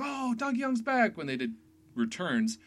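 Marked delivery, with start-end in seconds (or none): 0.0-1.2 high, drawn-out excited fan cry; super excited. Retold or imitated. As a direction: mimic fans thrilled at a comeback. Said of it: "they got super excited".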